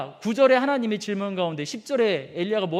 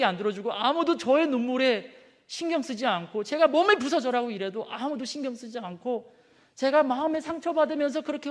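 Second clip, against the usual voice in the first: Elijah's lament; 5 semitones higher than usual. Acted. The preacher voicing Elijah complaining to God, high and pleading. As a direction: pour out a weary grievance to God.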